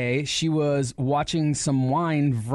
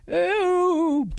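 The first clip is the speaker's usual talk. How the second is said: mock faint ooh. Dying woman voiced.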